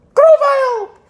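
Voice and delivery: very high, loud name call; excited squeal. Real, not acted. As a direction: shriek the name out high and long. Calls out the card's name.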